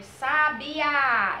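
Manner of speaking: SABIÁ drawn out as a call, last syllable held; measured call of SABIÁ, syllables stretched; calling a neighbour. Shown as a demonstration. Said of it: "Quando a gente vai chamar aquele vizinho".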